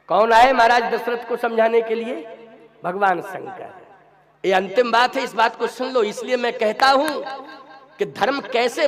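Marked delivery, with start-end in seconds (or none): none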